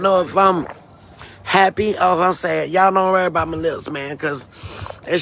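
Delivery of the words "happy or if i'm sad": ordinary delivery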